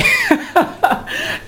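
high, soft chuckling run; real laugh. Real, not acted. Host amused by the whole dilemma, laughing. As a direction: break into light, high laughter. Amused after exclaiming over the letter.

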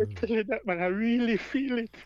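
moved, pitch lifted a little; getting emotional. Real, not acted. Guest telling host how deeply he feels it, emotional. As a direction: speak from real, welling feeling. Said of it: "I'm getting emotional".

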